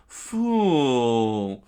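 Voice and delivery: loud, drawn-out cry; theatrical villain shout; screamed insult. Acted. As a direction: bellow it long and scornfully. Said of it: "Mister Two screamed out".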